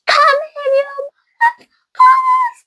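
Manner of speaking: very high, strained mock shriek; imitated high-pitched screaming taunt; panicked scream. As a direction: act out a taunted woman snapping in panic. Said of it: "She panics and she's like".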